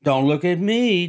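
Daisy retorting to her friend. A narrator's reading. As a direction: toss the blame right back. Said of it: "Daisy retorted".

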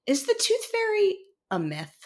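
put-on high-pitched child's question, clearly projected; imitating loud child. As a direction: mimic a kid asking for all to hear. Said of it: "she says this very loudly".

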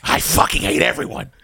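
a breathy joking whisper; whispered mock grumble. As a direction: whisper it like a secret grudge. Joking grumble after rant.

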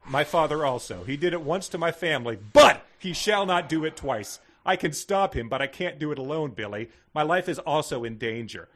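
impassioned dramatic read-out, bursts of volume; hammed-up proclamation; melodramatic note reading. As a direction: declaim it like an overwrought confession.

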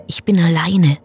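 desolate whisper. Acted. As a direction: breathe it out, voiceless. Repeated line, whispered.

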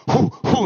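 hammed-up exertion puffs; mock workout grunts. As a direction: imitate a guy straining through push-ups. Imitating Steven's exertion grunts.

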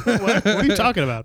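normal talking voice

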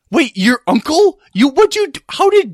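high, loud, sputtering disbelief; astonished. Acted. Learning Cutter is the friend's uncle, high loud astonishment. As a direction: break off each question in shock. Character learns of surprise relation.

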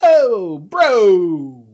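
"Oh, bro." in a thick New York accent; hammy New York "Oh, bro.". Shown as a demonstration. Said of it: "gotta say it with a New York accent".

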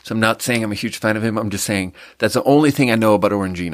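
ordinary talk

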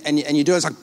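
usual speaking voice

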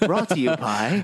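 slowed-down mock ad announcer; mock sponsor read. Podcast hosts joking about commercials, mock sponsor read. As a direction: play at reading the sponsor credit.

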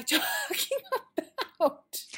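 laughing through the question, rising; giggling at losing the thread. Real, not acted. To her listener, laughing at getting lost. Loses her train of thought.

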